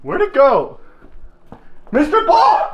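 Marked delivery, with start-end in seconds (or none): 1.9-2.7 high, raised searching call. Real, not acted. Seeker calling for the player he lost, raised call. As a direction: holler for someone who has slipped away.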